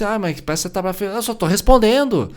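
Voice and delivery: put-on child and mother quarreling over tone; mock argument. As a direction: argue back like a hurt kid.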